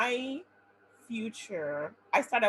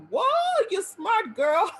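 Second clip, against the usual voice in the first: far higher, louder, slow praise; thrilled at her own test score, high, loud and slow; amazed delight; 11 semitones higher, 10 dB louder, much slower than usual. Retold or imitated. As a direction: gasp at your own score. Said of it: "I was like".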